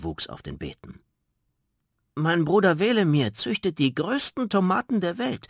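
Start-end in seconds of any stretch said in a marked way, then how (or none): 2.1-5.5 stolz, hoch und laut erzählt. Acted. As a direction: gib mit deinem Bruder an. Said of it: "berichtete Zwerg Goliath stolz". (Zwerg Goliath prahlt vor der Riesin, stolz und hoch)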